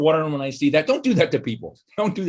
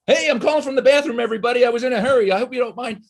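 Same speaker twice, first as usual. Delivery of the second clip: mock cheery caller; 9 semitones higher than usual. Mocking caller in the bathroom.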